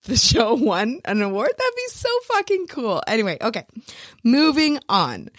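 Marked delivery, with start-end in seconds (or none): 1.6-3.0 giddy excitement. Real, not acted. Dreaming of winning award.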